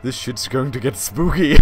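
spooky ghost voice with cackle; ghostly echo. Acted. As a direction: ham it up like a ghost. Ghost character voice.

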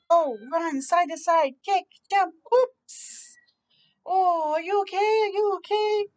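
play, then check on a friend; excited kids playing. Kids run and one falls, friend asks worriedly.

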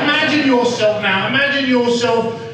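demonstrated Cockney, lilting upward; playful Cockney patter; Cockney accent. Shown as a demonstration. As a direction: tell the tale as a Cockney storyteller. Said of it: "Can you do a Cockney?".

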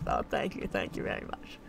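low, soft mock thanks; imitated grateful fans. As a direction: drop to a hushed, mostly voiceless thank-you. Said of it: "You guys are like".

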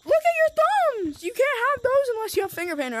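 mock-excited high kid voice; imitating excited kid. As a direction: mimic the kid pointing out the thumbs. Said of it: "And he's like".

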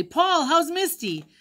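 put-on high, slow, eager child's cry; a little kid calling out, thrilled; excited child. A narrator's reading. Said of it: "voice cried excitedly".

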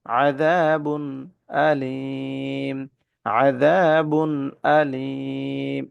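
আযাবুন আলীম drawn out very slowly, nun clear before আলীম; very slow tajweed recitation. Shown as a demonstration. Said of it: "স্পষ্ট করে পড়ব।".

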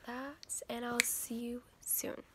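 whispering. Real, not acted. Quiet signoff to camera.

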